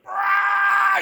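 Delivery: very high, loud comic scream; hammed-up shriek of pain; agonized scream. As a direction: scream as if your gut is exploding. Agony on toilet, acted out.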